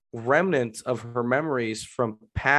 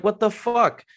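baffled; 8 semitones higher than usual. Retold or imitated. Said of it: "You're like".

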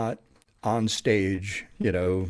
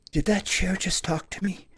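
put-on high-pitched small boy voice; little boy; 10 semitones higher than usual. Shown as a demonstration. Little boy in a haunted furniture store, childlike voice. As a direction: ask it high, as a puzzled little kid. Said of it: "playing a little boy".

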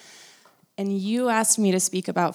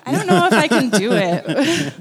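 laughing; 6 semitones higher, 8 dB louder than usual. Real, not acted. Nervous laugh about cussing.

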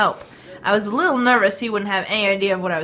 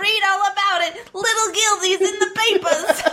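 high, hammed-up newsboy cry; old-timey paperboy, pitched way up; little newsboy; 8 semitones higher than usual. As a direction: hawk the extra edition to passers-by. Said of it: "What's it with the little newsboys say?".

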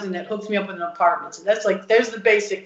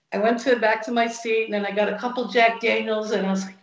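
usual speaking voice

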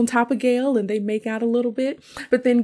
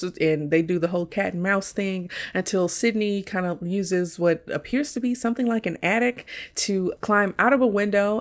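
normal talking voice

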